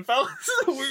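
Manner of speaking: laughing shout. Real, not acted. Laughing call out.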